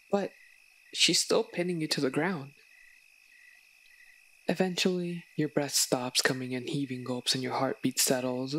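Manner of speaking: ordinary delivery, nothing marked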